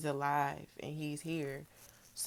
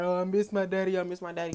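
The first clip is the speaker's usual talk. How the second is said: mock sad lament; 2 semitones higher, 5 dB louder, faster than usual. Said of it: "I'm not really, like, sad".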